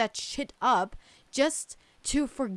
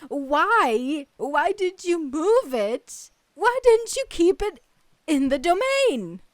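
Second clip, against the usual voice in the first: loud and high, demanding why; real angry outburst; 5 semitones higher, 7 dB louder, slower than usual. Real, not acted. Fan shouting at the developers over the moved statue, furious. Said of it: "Okay, I'm getting upset".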